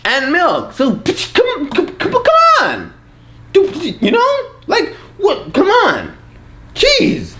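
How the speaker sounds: very high comic squeal of frustration; hammed-up kid meltdown; exasperated child.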